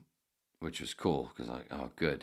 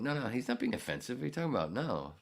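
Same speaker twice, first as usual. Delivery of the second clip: mock lady defending; 7 semitones higher, faster than usual. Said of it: "she interjects going".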